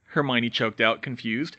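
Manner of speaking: usual speaking voice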